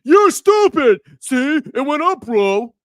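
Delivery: high, loud taunting bro voice; mock smug crypto bro voice. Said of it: "people are gonna be like".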